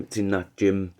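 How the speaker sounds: ordinary delivery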